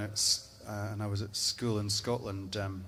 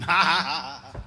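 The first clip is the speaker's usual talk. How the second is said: much louder, higher mock guffaw; roaring Highlander laugh; 6 semitones higher, 8 dB louder than usual. The Highlanders drink whiskey after battle, booming mock laughter. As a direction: laugh like a drunk victorious clansman.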